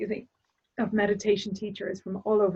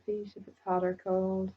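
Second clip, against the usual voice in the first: guided meditation. Acted. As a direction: guide the listener calmly into their body. Leads a closing meditation.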